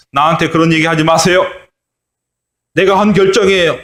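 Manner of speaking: a mock stern leader putting people in their place; imagined scolding. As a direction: leader scolding critics, pitch climbing. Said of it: "막 이렇게 야단을 쳤을 텐데".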